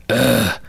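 exasperated imitated grunt; a put-upon dad's groan through the door. Son knocks, dad grunts in annoyance. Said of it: "That sounds exactly like your dad".

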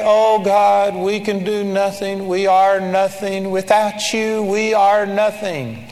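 droning monotone mock prayer; intoning a powerless plea in imitation; imitated helpless prayer. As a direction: pray like a defeated believer, drawn out on one note. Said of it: "You know how people pray".

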